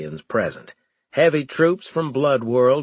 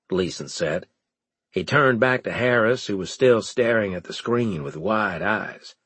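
plain reading voice

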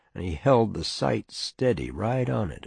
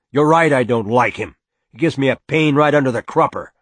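loud, higher, irritated father; angry retort; 5 semitones higher, 7 dB louder, faster than usual. Acted. Father admitting he dislikes the neighbour, angry retort. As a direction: fire back loudly with open dislike.

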